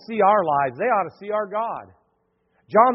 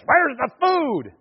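mock rude demand; 7 semitones higher, much slower than usual. Rude visitors demanding food.

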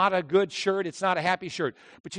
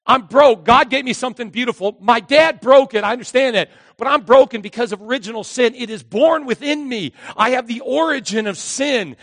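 loud impassioned preached confession, voice raised high; preaching at full force; 6 semitones higher, 9 dB louder than usual. Acted. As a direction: cry it out to God like a broken sinner.

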